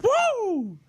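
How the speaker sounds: squealed high woo of triumph. Retold or imitated. Celebrating making it home, squealing woo. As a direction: let out a high victory woo. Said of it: "I was like".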